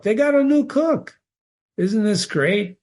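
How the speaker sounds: high-pitched, excited announcement; mock excitement. Cheering a plot turn, "Isn't this great?.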